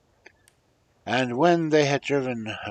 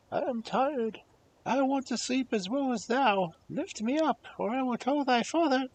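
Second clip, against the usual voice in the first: high, fast little frog voice; demanding frog asking for the golden plate. Acted. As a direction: squeak it out like a pushy frog. Frog threatens in bed.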